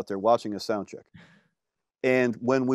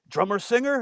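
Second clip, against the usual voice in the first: "Drummer singer?" asked high in a mock French Canadian accent; a second, higher try at the French Canadian accent; 9 semitones higher than usual. Away greets the drummer singer, in a mock French Canadian accent. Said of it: "I'm not doing a good French accent, French Canadian".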